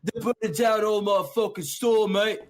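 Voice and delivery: put-on high-pitched English chav accent; mock chav outrage, pitched well up. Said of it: "a chav accent".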